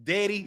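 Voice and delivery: "daddy" pronounced "deddy"; "daddy" rhyming with "ready"; Chicago pronunciation. Shown as a demonstration. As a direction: make the first vowel of "daddy" an eh. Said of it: "it is pronounced daddy".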